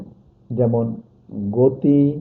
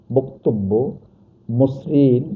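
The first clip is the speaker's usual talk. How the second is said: ordinary delivery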